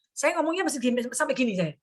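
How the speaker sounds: normal talking voice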